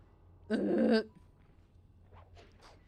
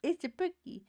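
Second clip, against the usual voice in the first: pet talk; 7 semitones higher, 4 dB louder than usual. Real, not acted. Greeting the pet.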